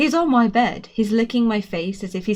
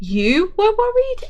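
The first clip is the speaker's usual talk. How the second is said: high, slow, loud squeak; boy just out of a coma, squeaky and high; thin squeaky voice; 12 semitones higher, 5 dB louder, slower than usual. A narrator's reading. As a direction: squeak it out after days of silence. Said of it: "thin, small, squeaky voice".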